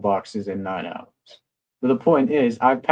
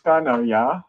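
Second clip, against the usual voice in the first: mock German accent; 6 semitones higher than usual. Shown as a demonstration. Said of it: "say it with the accent".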